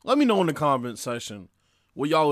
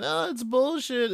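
mock whiny complaint, much higher; mocking the complainers; 11 semitones higher than usual. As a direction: parody the people crying foul. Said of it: "a lot of people are claiming".

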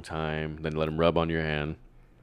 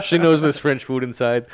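raised, laughing mock Jersey accent; 5 semitones higher, 5 dB louder than usual. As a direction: play it Jersey, louder and amused. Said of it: "I went for the Jersey accent for that".

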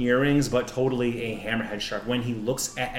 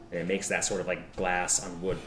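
normal talking voice

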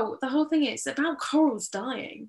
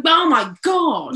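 mock self-pity; 5 semitones higher, 9 dB louder, much slower than usual. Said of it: "It's like".

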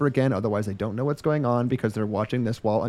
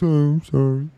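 dumb protagonist voice; 5 dB louder than usual. Retold or imitated. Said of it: "And he goes".

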